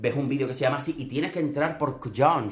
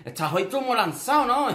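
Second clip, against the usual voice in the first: mock teasing quote, pitched higher and a bit louder; teasing Iván; 10 semitones higher, 3 dB louder than usual. Iván teasing a flirty host, pitched up and mocking. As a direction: tease him like a sly friend. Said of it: "y va y dice".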